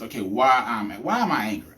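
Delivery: usual speaking voice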